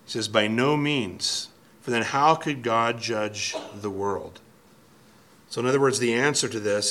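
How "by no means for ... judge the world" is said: very slow, weighty quote; emphatic. Retold or imitated. Preacher quoting Paul's firm denial, slow and emphatic. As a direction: lay each word down slowly and firmly. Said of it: "very emphatic".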